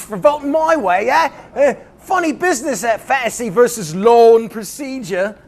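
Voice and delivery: high-pitched, exaggerated Cockney; hacky Cockney accent. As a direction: ham up a cheap Cockney English voice. Hacky Cockney Farage voice returns.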